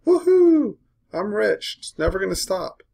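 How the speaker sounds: high, loud mock whoop of glee; mock euphoria. Mocking dip buyers to his students, a high loud gleeful whoop. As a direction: ham up a greedy trader cheering his win.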